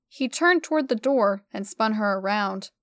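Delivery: plain reading voice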